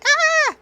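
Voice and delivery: high delighted shriek; excited squeal. Real, not acted. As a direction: let out a loud happy squeal. Squeal at horse on screen.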